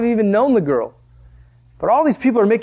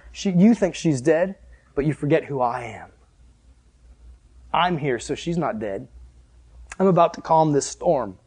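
gentle Jesus; 5 semitones lower, 4 dB quieter than usual. Acted. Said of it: "And so he says".

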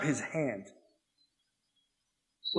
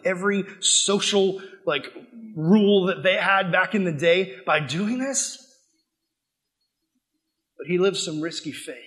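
normal talking voice

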